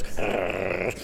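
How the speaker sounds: imitated growl of the dog. Handler imitates the drunk dog growling at him. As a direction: growl back like the defiant dog. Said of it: "and he goes".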